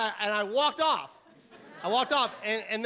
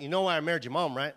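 low, casual dinner-table dad; dad's voice; 6 semitones lower, faster than usual. Retold or imitated. Dad to his kid at the dinner table, low deadpan dad. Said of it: "He's like".

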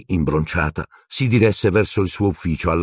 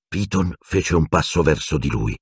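ordinary delivery, nothing marked

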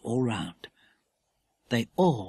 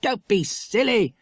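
put-on slow, brushing-off boy voice; boy dismissing his sister's fear, scoffing; dismissive; 7 semitones higher, 3 dB louder than usual. A narrator's reading. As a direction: wave the fear away. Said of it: "began Philip".

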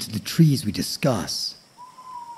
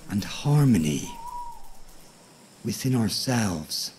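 nature documentary. A narrator's reading. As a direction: lull the listener toward sleep. Sleep story narration of tree.

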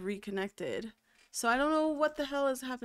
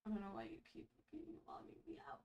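whisper; 19 dB quieter, much faster than usual. Real, not acted. Muttering at the login system.

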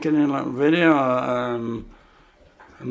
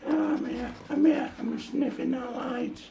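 higher, softer put-on dog voice, nosing around; a dog chattering to dad while sniffing a light, higher and softer; dog voice, busy sniffing; 11 semitones higher, 4 dB quieter than usual. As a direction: voice a curious dog exploring. Dad voicing Dexter sniffing.

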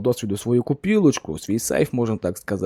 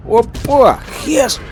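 sudden high, loud "Опа!"; a yelp of joy, then a high cry of the shell name; excited exclamation; 11 semitones higher, 5 dB louder, much slower than usual. Real, not acted. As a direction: burst out with real delight at the hit. Lucky high-damage shot lands.

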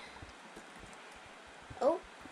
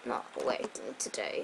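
voice giving out; 12 semitones lower, 5 dB quieter than usual. Real, not acted. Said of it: "Sorry, my voice".

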